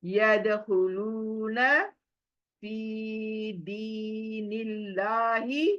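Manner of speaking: slow recitation, each word separated. Shown as a demonstration. Teacher reads the verse word by word to the pupil. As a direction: recite one word at a time, slowly. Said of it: "पहले एक एक word को देखो".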